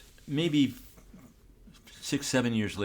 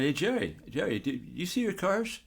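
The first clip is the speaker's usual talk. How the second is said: higher-pitched friendly call for attention; retold calling out; 8 semitones higher than usual. Photographer hailing a star in the lobby, retold higher-pitched call. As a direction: call over to someone, pitched up.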